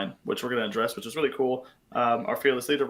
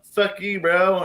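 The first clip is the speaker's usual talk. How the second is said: dismissive quote; 7 semitones higher, 5 dB louder, much slower than usual. Retold or imitated. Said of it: "being like".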